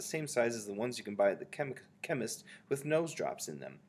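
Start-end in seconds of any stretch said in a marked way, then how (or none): none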